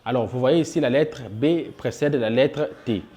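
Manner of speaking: ordinary talk, nothing marked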